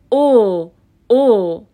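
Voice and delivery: "او" as a long rounded oh; plain O vowel. Shown as a demonstration. Teacher showing the easy fifth vowel, "او" as long rounded oh. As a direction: hold "او" as a pure o. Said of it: "تلفظ این حرف صدادار".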